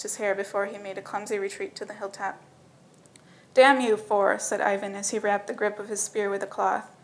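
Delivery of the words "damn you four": loud cursing at the dragon; angry curse. Acted.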